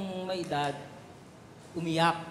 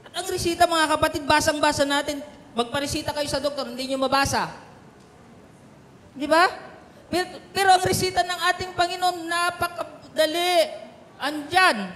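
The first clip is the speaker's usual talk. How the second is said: full-force preaching; 10 semitones higher, 8 dB louder than usual. Acted. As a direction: drive home the point to the church. (malakas at mataas na sermon)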